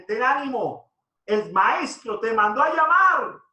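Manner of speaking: rally him with good news, retold; acted encouraging call. Crowd calls encouragement to blind man. (llamado de ánimo imitado)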